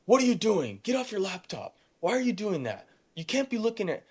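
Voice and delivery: teacher scolding. Retold or imitated. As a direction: snap at the student like a nagging teacher. Teacher scolding him at laptop.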